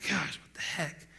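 slow, hushed acted grumble; muttered disbelief. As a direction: mutter it under your breath in doubt. Voicing doubting Peter's muttered reaction.

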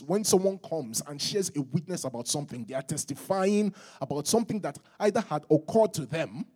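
usual speaking voice

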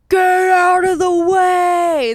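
drawn-out, high, loud mock holler; imitated yelling. Cop who kept yelling at her, imitated yelling. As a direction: bellow it slowly, stretching the words. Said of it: "he kept yelling".

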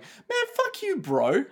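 high-pitched indignant retort; hammed-up squeaky indignation; mock outrage. Imagining an onlooker to the kissing, high mock outrage. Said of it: "being like".

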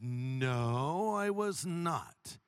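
drawn-out no, low then rising; slow, firm mock denial; emphatic denial. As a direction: stretch out a very slow, deliberate refusal. Firm refusal to stranger, acted.

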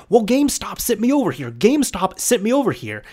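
imitating a flustered, indignant mom; flustered insistence. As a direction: repeat it like a flustered customer insisting. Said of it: "she just got so flustered".

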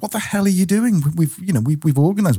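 high, incredulous mock outburst from mum; exasperated mum. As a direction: act out mum's shocked disbelief.

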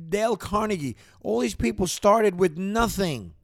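rally the listeners with rising passion; real excitement over self-made men; excited. Real, not acted. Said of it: "maybe I got a little excited".

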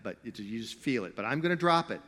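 usual speaking voice

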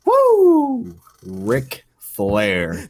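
high, loud drawn-out "woo", then the name; Ric Flair. Acted. Naming a convention guest with his signature whoop, hammed-up wrestler impression. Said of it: "that sounded really good on here".

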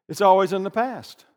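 usual speaking voice

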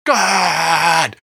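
high, loud echoed shout. Retold or imitated. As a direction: yell it back like the singer. Echoing the shouted quote.

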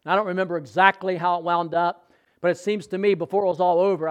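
ordinary talk

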